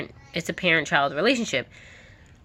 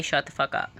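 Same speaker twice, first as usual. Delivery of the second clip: imitated mother; 4 semitones lower, 4 dB quieter than usual. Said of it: "your own mother will talk to you like that".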